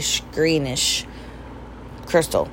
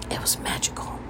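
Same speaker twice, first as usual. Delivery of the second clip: soft dreamy whisper, almost no voice; 12 dB quieter than usual. Real, not acted. Telling viewers about her couple, awed whisper. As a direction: breathe it like a secret wonder.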